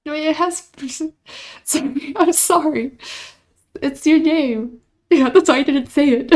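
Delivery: high, loud, laughing apology; embarrassed laughter. Real, not acted. Streamer to chatter after mangling their name, laughing loudly. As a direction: laugh through the apology, flustered.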